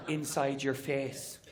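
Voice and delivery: low, soft and slow; Irish mother voice. Retold or imitated. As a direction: let the insult drop slowly and low. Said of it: "she'll go".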